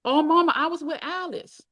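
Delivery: put-on high-pitched teenage pleading; pleading daughter. A narrator's reading. Daughter owning up to her angry mother, pleading.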